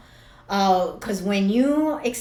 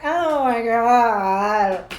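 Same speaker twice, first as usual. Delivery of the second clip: retold crying; 3 semitones higher, 6 dB louder, much slower than usual. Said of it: "I cried at my little register".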